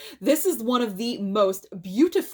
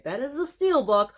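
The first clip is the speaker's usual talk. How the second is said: higher, emphatic show-off; excited emphasis; 4 semitones higher than usual. Shown as a demonstration. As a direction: stress the reveal, pitch up. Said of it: "if you could see it".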